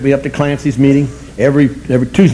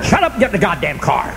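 high-pitched mock yell; imitated blunt sponsor; 12 semitones higher, 4 dB quieter than usual. Sponsor to newcomer in the car, mock-yelled scolding. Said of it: "But he knew how to put it".

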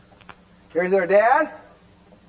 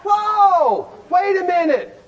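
surprised; 6 semitones higher, slower than usual. Real, not acted. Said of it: "preacher stops the story".

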